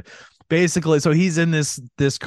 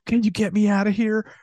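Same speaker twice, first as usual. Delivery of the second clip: high-pitched mock victim voice; lightly acted higher, pleading voice; imitated pleading woman; 7 semitones higher than usual. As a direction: play a captive asking for help. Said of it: "she's like".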